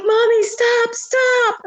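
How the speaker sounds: very high mock-panicked plea; imitating frightened kids. Kids begging mom in the woods, mock-terrified.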